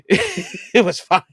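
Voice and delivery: slow, laughing through the words; brushing off a friend's fuss while laughing. Real, not acted. Laughing off his friend's reaction.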